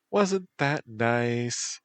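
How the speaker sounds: put-on coy valley girl drawl.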